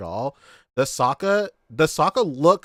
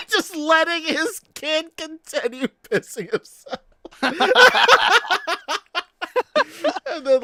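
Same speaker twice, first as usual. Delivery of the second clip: said high through leftover laughter; laughing through words; 5 dB louder than usual. Real, not acted. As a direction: still cracking up while talking. Still laughing at the story.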